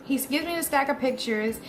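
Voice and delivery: ordinary delivery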